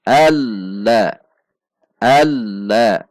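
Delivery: Alla with the doubled lam held; lam tasydid. Shown as a demonstration. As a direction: lean on the lam in Alla then release. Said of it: "ada lam tasydid".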